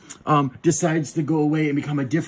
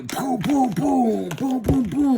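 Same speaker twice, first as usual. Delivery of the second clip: high, punchy mouth-made booms; playful battle sound effects; mock explosion noises; 9 semitones higher, 3 dB louder, slower than usual. Robots fighting in a retold match, mock explosions.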